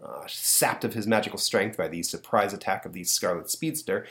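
ordinary talk

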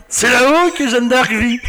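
high, slow pompous put-on voice; mock Le Pen voice. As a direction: ham it up like a pompous politician. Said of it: "T'imagines Jean-Marie Le Pen en train de tenir Marie". (voix parodique de Jean-Marie Le Pen en riant)

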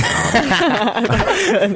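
high laughing aside. Real, not acted. Laughing joke ending.

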